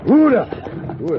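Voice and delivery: a loud, high call to halt the horses; calling whoa. Acted. Driver calling to his horses to stop, loud. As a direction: haul the team up short with a shout.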